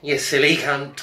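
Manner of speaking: loud exasperated punchline; angry insult. Retold or imitated.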